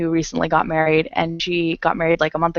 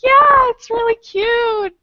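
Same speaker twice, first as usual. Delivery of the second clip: high, slow sweet reassurance; sweetly innocent; 5 dB louder, slower than usual. Retold or imitated. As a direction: sell it sweetly. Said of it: "I was like".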